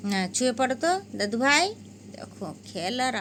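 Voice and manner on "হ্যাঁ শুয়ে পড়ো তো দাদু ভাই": quick louder coaxing; fast loud pet talk; urging the kitten. Real, not acted. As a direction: hurry the kitten to bed. Telling kitten to lie down.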